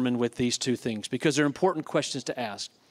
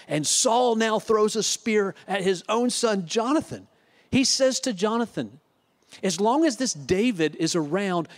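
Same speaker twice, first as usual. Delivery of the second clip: higher, urgent preacher's voice, driving the point home; fired-up preaching; 4 semitones higher than usual. Acted. Pastor stirring the congregation over Saul's madness, impassioned. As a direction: preach it with rising heat.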